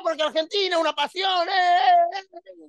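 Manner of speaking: very high voice breaking into comic sobs; fake-cry about Argentina; mock tearful fan. Preacher imitating a fan sobbing over Argentina's passion.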